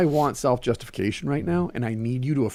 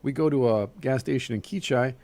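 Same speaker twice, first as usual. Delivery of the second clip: ordinary delivery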